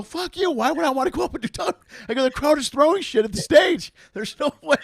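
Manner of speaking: high, loud mock outrage at the offer; incredulous refusal. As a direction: squeal a mocking no way.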